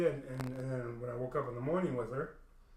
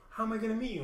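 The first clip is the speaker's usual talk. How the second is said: pleading line in a much higher mock voice; imitating Haley; 9 semitones higher, 4 dB louder, much faster than usual. Haley asking as he walks away, high mock plea. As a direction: lift it high like her whining.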